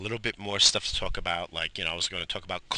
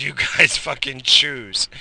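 laughing through the words, a bit louder; 4 semitones higher, 9 dB louder, slower than usual. Real, not acted. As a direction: laugh out the dare to pick. Telling family to pick takeout.

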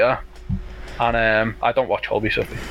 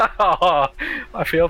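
laughing; 2 semitones higher than usual. Real, not acted. Laughing at own sad story.

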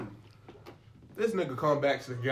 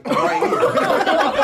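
laughing yell; 10 dB louder, much slower than usual. Retold or imitated. Cursing customer from behind glass.

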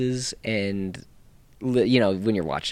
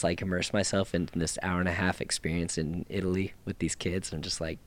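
normal talking voice

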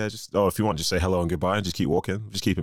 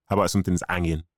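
broad Manchester accent; Mancunian accent; faster than usual. Shown as a demonstration. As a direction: say it like a local Mancunian. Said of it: "I'm gonna say it in a Mancunian accent".

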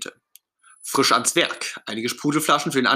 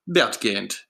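usual speaking voice

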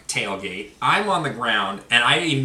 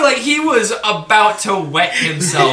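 laughing; 4 semitones higher, 5 dB louder, slower than usual. Real, not acted. Laughing retelling the reaction.

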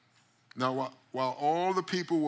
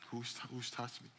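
timid hush; 11 dB quieter than usual. Acted. Trembling woman repeats question.

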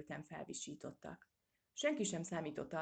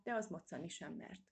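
plain reading voice

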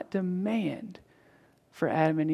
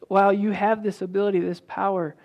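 usual speaking voice

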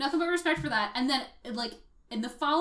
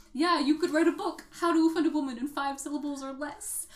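a hint of a higher, drier sarcastic voice; snarky Rogers; 3 semitones higher than usual. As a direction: needle the prince with dry sarcasm.

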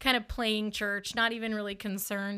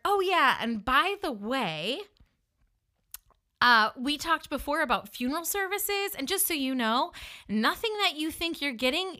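higher, pointed "oh by the way"; spiteful parting shot; 5 semitones higher than usual. Retold or imitated. Woman sealing the deal against her date, pointed. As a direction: throw in one last smug jab.

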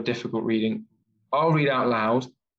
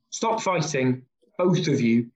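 ordinary talk, nothing marked

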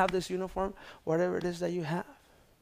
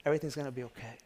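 reassuring to neighbour; 7 dB quieter, much faster than usual. Real, not acted. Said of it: "tell them".

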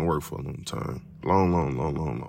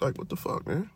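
ordinary delivery

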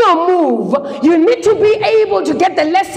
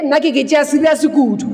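ordinary talk

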